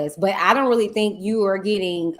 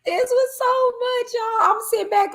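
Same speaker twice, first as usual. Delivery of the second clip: overwhelmed exclaim; 4 dB louder than usual. Real, not acted.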